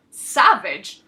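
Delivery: loud offended echo of the insult; a soldier outraged at being called savage; indignant. Acted. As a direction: throw the word back, affronted and loud.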